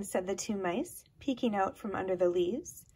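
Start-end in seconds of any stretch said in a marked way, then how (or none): none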